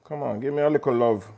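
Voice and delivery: ordinary talk, nothing marked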